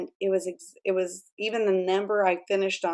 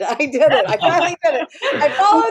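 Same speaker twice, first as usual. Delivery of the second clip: higher, louder, laughing with pride; triumphant laughing; 7 semitones higher, 8 dB louder than usual. Retold or imitated. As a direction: celebrate finally doing it. Proud announcement she finished book.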